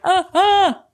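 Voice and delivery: short scared squeak; quick startled ahs; yelp. Retold or imitated. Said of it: "They're like".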